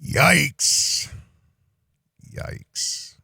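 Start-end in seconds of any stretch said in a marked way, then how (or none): 2.3-3.1 hushed, voiceless reaction; whispered. Real, not acted. Host reacts to the clip, whispered. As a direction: whisper it under your breath. Same word repeated in a whisper.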